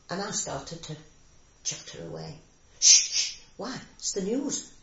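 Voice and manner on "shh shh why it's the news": sharp, loud shushing, then a raised voice; shouting father. Retold or imitated. Daughter recalls father silencing her chatter during the news, mock shout. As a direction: imitate a father loudly hushing a child. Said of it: "my father shouting at me".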